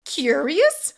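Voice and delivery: high, loud, incredulous snap; snapping. A narrator's reading. Assassin echoing the man's excuse, snapping. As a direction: throw the word back at him. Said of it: "Emery snapped".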